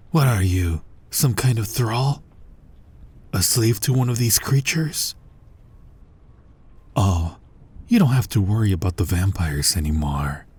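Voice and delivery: normal talking voice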